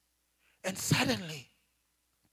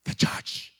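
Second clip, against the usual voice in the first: voice of the Lord; 8 dB louder than usual. Retold or imitated. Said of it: "The Lord spoke to me".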